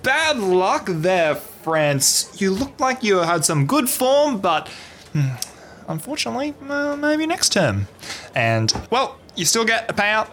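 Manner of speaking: high, slow consoling stall keeper; carnival host. Acted. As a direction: drawl the sympathy like a booth host.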